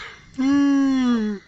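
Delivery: long yawning sigh sliding down; big yawn. Real, not acted. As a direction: let out a big open yawn. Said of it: "Oh god, I'm yawning".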